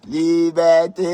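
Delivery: high, loud call with a long held last vowel; jubilant, elongated shout; drawn-out cheer. Real, not acted. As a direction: cry "Liberté" out, stretching the end. Gleeful cheering on the live.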